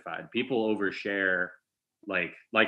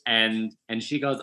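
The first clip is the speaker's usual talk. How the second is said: usual speaking voice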